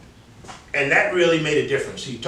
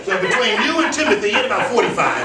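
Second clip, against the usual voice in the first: talking through laughter, higher and louder; real laughter in the voice; laughing; 9 semitones higher, 8 dB louder, faster than usual. Real, not acted. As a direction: keep laughing as you speak. Laughing while giving feedback.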